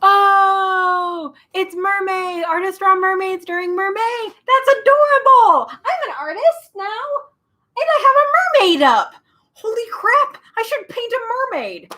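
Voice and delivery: very high, loud delighted squeals; excited squeal. Real, not acted. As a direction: gush with delight. Delighted squealing about mermaids.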